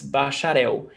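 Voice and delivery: "bacharel" with its L said as "oo"; Brazilian final L. Shown as a demonstration. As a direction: end "bacharel" in "-EH-oo". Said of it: "In Brazilian Portuguese".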